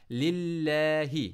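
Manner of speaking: slow chanted लिल्लाहि; recitation. Shown as a demonstration. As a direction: intone it like tilawat. Quranic recitation. (लिल्लाहि तिलावत के अंदाज़ में)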